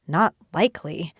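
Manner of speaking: ordinary delivery, nothing marked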